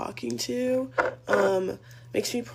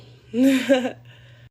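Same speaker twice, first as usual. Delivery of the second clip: loud, short laugh; incredulous real laugh; 4 semitones higher, 11 dB louder than usual. Real, not acted. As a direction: burst out laughing in disbelief. Laughs in disbelief after saying no.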